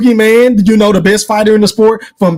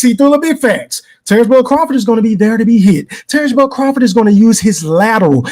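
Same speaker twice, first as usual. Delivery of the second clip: ordinary talk, nothing marked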